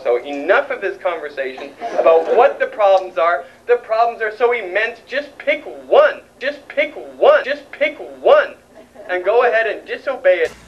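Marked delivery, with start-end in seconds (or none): 5.1-8.6 slow, high, emphatic just pick one, rising on one; insistent urging. Real, not acted. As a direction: drive it home word by word. Urging listeners to act.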